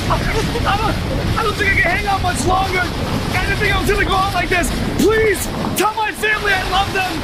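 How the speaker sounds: halting, high last-words gasp; hammed-up dying farewell; wounded soldier gasping. Reporter fakes a dying farewell.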